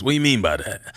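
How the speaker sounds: low, quick comic celebrity impression; Drewski. Repeating the hiker's reaction, Drewski impression. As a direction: toss it out as a baffled impression. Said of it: "my best Drewski voice".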